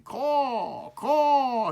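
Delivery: high, loud crow caw called out. A narrator's reading. Crow calling out from a high tree, high and loud. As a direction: call out like a crow from a treetop. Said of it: "he called".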